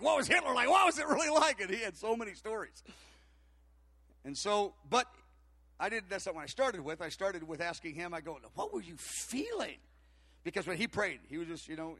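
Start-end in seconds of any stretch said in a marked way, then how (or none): 0.0-1.6 higher, louder rush of questions; eager questioning. Retold or imitated. Retelling his eager questions. 8.5-9.8 high, drawn-out puzzled question; stretched, high-pitched wondering; incredulous question. Retold or imitated. Re-enacting his puzzled question.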